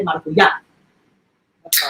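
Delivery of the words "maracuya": maracuya with stress on the final A; Spanish stress. Shown as a demonstration. Enjoying the Spanish word, maracuya accented on last A. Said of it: "acentos on the last A".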